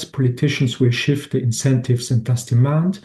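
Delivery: ordinary delivery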